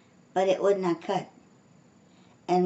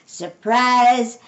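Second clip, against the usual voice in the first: raised, louder happy-party cry; shouted surprise; 5 semitones higher, 6 dB louder than usual. A narrator's reading. Amelia Bedelia runs out with gifts, shouting. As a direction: call it out louder and higher. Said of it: "Amelia Bedelia shouted".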